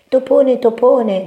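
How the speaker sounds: high, loud call for help; grido di Topino. A narrator's reading. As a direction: cry out like a frightened little mouse. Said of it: "gridò Topino". (grido acuto di topolino)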